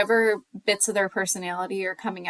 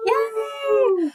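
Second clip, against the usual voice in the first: high, drawn-out yay; excited cheer; 13 semitones higher, 4 dB louder than usual. Real, not acted. Host cheering the Snackatory opening, high excited yay.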